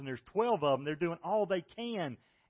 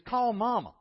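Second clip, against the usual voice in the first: put-on higher, louder, a ten-year-old begging to call mom; scared kid pleading; 7 semitones higher, 6 dB louder than usual. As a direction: plead like a frightened boy. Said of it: "what he was saying is".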